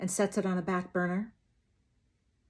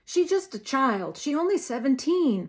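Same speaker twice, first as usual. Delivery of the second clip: begging father; 5 semitones higher, 4 dB louder than usual. A narrator's reading. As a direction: beg for his child.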